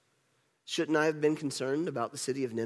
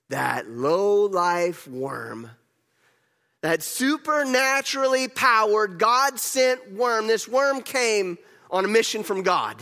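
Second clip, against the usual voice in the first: slow, swelling to higher and louder outrage; indignant at the worm; 4 semitones higher, 5 dB louder, slower than usual. Acted. Jonah cursing the worm that killed his vine, building mock outrage. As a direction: build the insult word by word.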